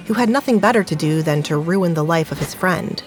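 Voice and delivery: ordinary talk, nothing marked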